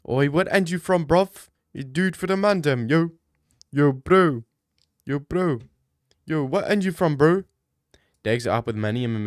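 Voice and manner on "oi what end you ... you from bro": loud London roadman bravado; london roadman accent. Pretend.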